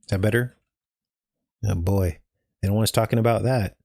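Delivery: ordinary delivery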